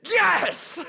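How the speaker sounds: high, loud victory whoop; triumphant cheer. Retold or imitated. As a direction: celebrate the perfect throw. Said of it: "my response was".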